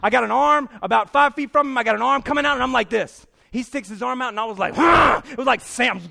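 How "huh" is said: loud high "huh"; hammed-up attack yell; explosive grunt. Man meeting the dude's outstretched arm, explosive yelled grunt.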